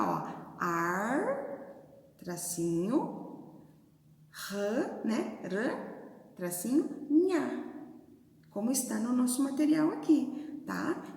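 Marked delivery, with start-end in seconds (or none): 0.6-7.6 arranha dictated slowly as ar, rã, nha; syllables dictated. Shown as a demonstration. Teacher showing pupils how arranha splits into ar, rã, nha. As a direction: spell it out syllable by syllable. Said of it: "A Pro não falou só o A".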